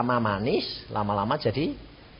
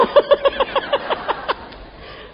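high breathy burst of laughter; real laugh at the anecdote. Real, not acted. Laughs at husband's story.

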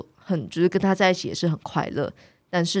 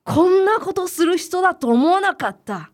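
higher and louder, a hurt scolding; stern reproach in Japanese; 12 semitones higher, 5 dB louder than usual. Retold or imitated. The angry archer man tells his tenant he betrayed his trust, a loud hurt rebuke. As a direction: deliver the old man's grave disappointment sharply. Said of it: "我也想听他怎么用日文讲的".

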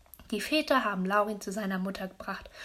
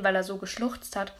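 usual speaking voice

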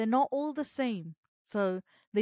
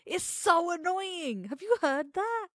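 much higher, thin voice; 9 semitones higher, 3 dB louder than usual. Shown as a demonstration. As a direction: talk way up high. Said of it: "Am I doing it right now".

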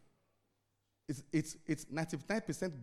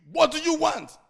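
usual speaking voice

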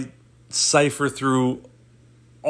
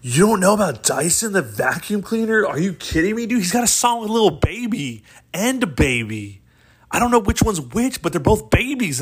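higher-pitched mock outrage of a fan; incredulous fan; 5 semitones higher than usual. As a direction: act appalled that they haven't heard of him. Mock fan acting incredulous at him.

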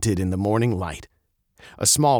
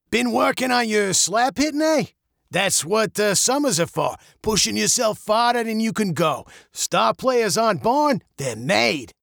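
high, lively coach voice; a small-town coach cheering a kid on; hearty coach; 11 semitones higher than usual. Acted. Coach character voiced in story.

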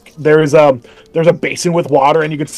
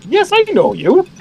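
sweet elf woman; 13 semitones higher than usual. Acted. Elf woman greets the returning twins, high cheerful welcome. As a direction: gush over the guests like a doting host.

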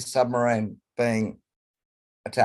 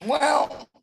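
voice breaking. Real, not acted. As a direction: voice cracks up high. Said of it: "listen to the voice break then".

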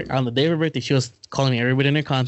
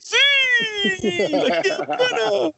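high shouted Spanish radio hype; radio announcer voice; much slower than usual. Retold or imitated. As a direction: belt it like a Spanish radio host. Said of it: "doing that fucking voice".

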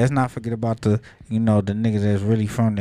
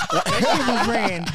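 laughing; 9 semitones higher, slower than usual. Real, not acted. Laughing while repeating praise.

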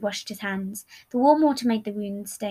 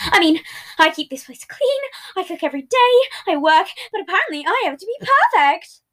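higher, indignant rant; heated complaint; 8 semitones higher, 6 dB louder than usual. Acted. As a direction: list grievances indignantly.

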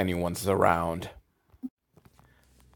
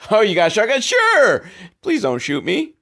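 high, loud mock-eager surprise; nervous driver; 10 semitones higher, 4 dB louder than usual. Driver sees a shotgun, squeaky mock-eager surprise. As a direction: fake cheer covering fear. Driver voiced as scared hitchhiker host.

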